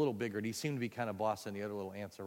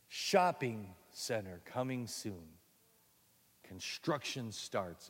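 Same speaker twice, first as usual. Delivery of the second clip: reading the sign; much slower than usual. Acted.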